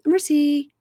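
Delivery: high, light French merci; own French merci. Retold or imitated. Teasing wife over French in stores, lilting Merci. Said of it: "made fun of me in France".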